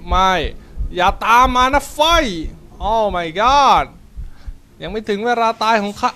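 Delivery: loud, high frightened pleading; panicked shouting. Real, not acted. Fleeing the monster, begging it to stay back, panicked yell. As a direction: yell it in real panic while running.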